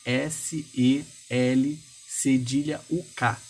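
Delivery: slow, letter-by-letter spelling; each letter said slowly and separately. Shown as a demonstration. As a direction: spell slowly for someone typing along. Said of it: "se você quiser digitar".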